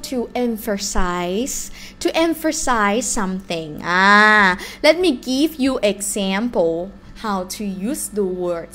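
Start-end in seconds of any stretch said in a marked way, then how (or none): none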